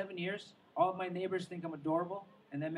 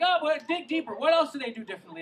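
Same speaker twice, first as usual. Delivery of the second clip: higher, louder mock insistence; mock pushy question; 8 semitones higher, 9 dB louder than usual. People pushing him about cats and dogs, loud mock prodding. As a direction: needle him, pitched up and loud.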